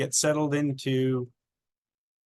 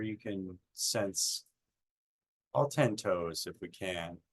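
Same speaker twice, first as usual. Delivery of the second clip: lower, softer meditation voice with long pauses; guided meditation; 4 semitones lower, 4 dB quieter than usual. Acted. Guiding listeners' attention up from their toes, calm meditation voice. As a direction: ease them gently through a body scan, unhurried. Leads a body scan meditation.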